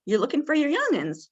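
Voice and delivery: high, curious question; curious question back to a confused resident; asking it back. Shown as a demonstration. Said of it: "really ask some questions".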